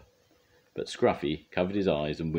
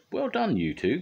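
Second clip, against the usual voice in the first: high congratulatory call; 13 semitones higher, 3 dB louder than usual. A narrator's reading. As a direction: call out praise in a raised pitch. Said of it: "called Bob".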